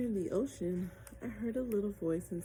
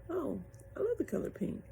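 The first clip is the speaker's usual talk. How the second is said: higher light mermaid voice; bubbly little mermaid; 9 semitones higher than usual. A narrator's reading. Mermaid voice answering.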